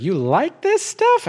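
high, drawn-out mock disbelief; incredulous friend. Preacher mimics a puzzled listener, high and slow. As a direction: play the baffled friend asking slowly. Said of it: "be like".

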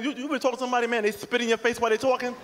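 ordinary delivery

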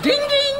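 high sung ding ding; bell ding imitation. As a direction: chime like a call bell. Mimicking call bell.

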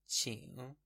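ordinary delivery

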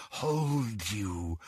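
low, very soft hold; whispered. A narrator's reading. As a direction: breathe it quietly. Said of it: "whispered the voice".

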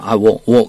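"will" cut down to "wo"; quick reduced "will", sounding like "wo"; American reduced will. Shown as a demonstration. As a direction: drop the L of "will", leaving "wo". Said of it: "이렇게 발음을 해요".

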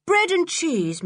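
high, falling, brisker offer; taunting offer. A narrator's reading. Boy offering Missus Stick his poor supper, taunting. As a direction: make the offer in a lofty, high pitch.

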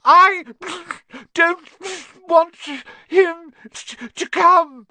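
high, loud, blubbering tantrum yell; fake-sobbing yell. A narrator's reading. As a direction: wail each word like a spoilt child. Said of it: "Dudley yelled between huge pretend sobs".

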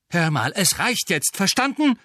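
angry yell cutting him off; bursting out. Acted. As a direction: blurt it out, losing patience. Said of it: "platzte Harry heraus".

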